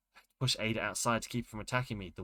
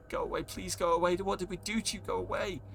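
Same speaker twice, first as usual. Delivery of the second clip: high, pleading cry to go away; terrified high plea; frightened pleading; 8 semitones higher than usual. Acted. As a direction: beg the monster to leave. Pleading with the beast.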